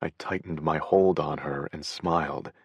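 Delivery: ordinary delivery, nothing marked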